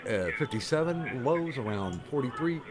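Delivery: lively TV weatherman read with punched day names; sing-song forecast delivery; mock weather report. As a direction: deliver the forecast like a local broadcaster handing off to sports.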